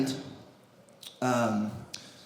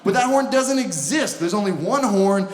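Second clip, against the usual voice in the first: ordinary talk, nothing marked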